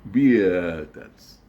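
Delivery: "[name]" stretched into "Bee-eh-tats"; misspelled-name reading. Shown as a demonstration. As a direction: draw out an extra "eh" inside "[name]". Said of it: "is read as".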